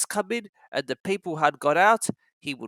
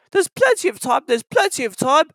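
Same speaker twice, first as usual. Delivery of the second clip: high, loud grumpy coaches; grumbling; 10 semitones higher, 5 dB louder than usual. A narrator's reading. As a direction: grumble it back. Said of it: "grumbled the coaches".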